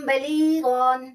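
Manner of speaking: बलीगन drawn out very slowly; slow recited demo. Shown as a demonstration. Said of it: "इसी तरह कौलम". (बलीगन को धीरे खींचकर)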